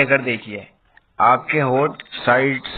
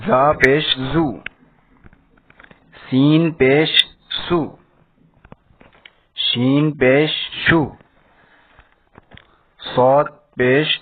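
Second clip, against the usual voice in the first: slow recitation drill; slower than usual. Shown as a demonstration. Teacher to young pupils, reciting the pesh sounds in a slow, measured drill. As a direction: recite each letter with a pause after it, like a class drill.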